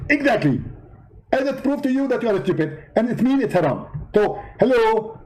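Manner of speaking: high, indignant preacher voice; Zakir Naik impression. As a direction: play the offended debater, pitched well up. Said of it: "Zakir Naik?".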